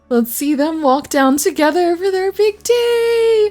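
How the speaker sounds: high teary squeal of delight; gushing. Real, not acted. Streamer watching her couple walk the aisle, teary high gushing. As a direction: melt over the couple walking down.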